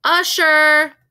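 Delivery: loud high call across the theater; crying out. Acted. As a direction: call the usher loudly. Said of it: "she cried".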